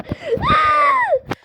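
high scared gasp; real frightened gasp; shrill gasp of fright. Real, not acted. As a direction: gasp as something jumps out. Sudden scare in game.

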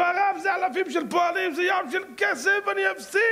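high, shouted objection; the factory owner shouts back at the rabbi in protest. Acted. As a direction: shout the worry about losing money. Owner protests losing money.

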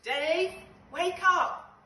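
loud high wake-up call; shouting. Acted. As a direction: yell to wake him, urgent. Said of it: "shouted Bug".

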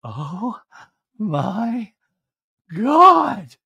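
stretched out word by word, climbing high; hammed-up smitten gasp; mock swooning. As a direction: breathe each word apart, swelling to a high squeal. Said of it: "once again she's like".

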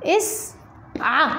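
اسعى said very slowly, ending on a short fatha; slow model word. Shown as a demonstration. Teacher showing students the new form, slow and clear. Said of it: "فتصبح الكلمة ماذا؟".

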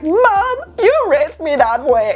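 high mock retort to mom; talking back to mom. Daughter retorting to her mom, high mock protest. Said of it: "be like".

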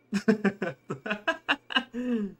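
loud high laugh; burst of laughter. Real, not acted. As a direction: crack up loudly. Laughing at bug.

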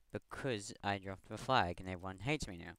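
ordinary talk

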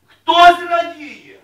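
very slow, loud stretched words; preacher slowing right down to stress joy; drawn-out emphasis. Acted. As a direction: linger on each word for weight.

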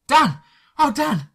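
high, loud frantic scream of his name; Faye shrieking. Acted. Faye finding injured Dan, high frantic shriek. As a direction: shriek it out in panicked relief. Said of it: "Faye screamed and shrieked at me".

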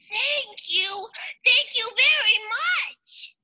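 high, bubbly thank-you shout; playful little fish. Acted. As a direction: gush with thanks like a tiny fish. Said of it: "bubbled playfully".

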